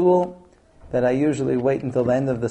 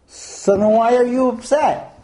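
exasperated husband, pitched high; mock yelling; 10 semitones higher, 4 dB louder than usual. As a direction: demand an answer in a raised voice. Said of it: "You yelled at me".

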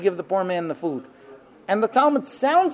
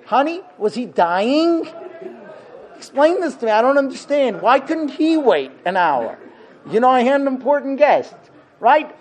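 indignant husband; 5 semitones higher than usual. Retold or imitated. Husband demanding answers from his late wife, mock indignant. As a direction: complain to your wife as if wronged. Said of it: "We'd say".

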